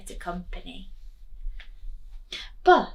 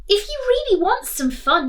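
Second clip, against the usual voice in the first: higher, louder cheerful monster; Dave the monster; 11 semitones higher, 7 dB louder than usual. Acted. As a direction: invite them to the party. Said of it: "he said".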